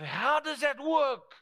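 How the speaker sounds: high, insistent puzzlement; baffled questioning. Retold or imitated. Preacher voices people who don't understand, baffled. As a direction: act out someone who can't make sense of it.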